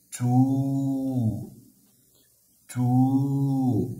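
تو with a long held vowel; long vowel syllable. Shown as a demonstration. Teacher showing the long waw, drawn-out تو. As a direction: draw out the waw in تو. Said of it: "ماذا نقول؟".

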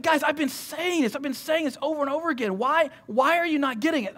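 imitating a teacher at wit's end; exasperated teacher. Acting Jesus exasperated with disciples.

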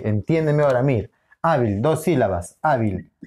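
ordinary talk

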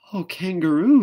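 high, loud frightened call; frightened cry. Acted. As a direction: cry out in fear. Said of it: "she cried".

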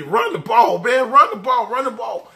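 half-scream it like a fan at the screen; retold TV yelling. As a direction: excitedly re-enact pleading with the team on TV. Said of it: "I'm just screaming at the T V".

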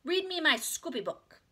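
high, slow, loud demand; demanding child. A narrator's reading. Little sister wants her book, demanding.

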